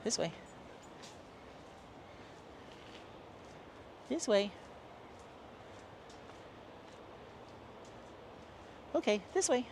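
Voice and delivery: short high-pitched coaxing calls; calling a dog. Real, not acted. Owner calling the dog away from the water, high-pitched. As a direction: steer a pet along, high voice.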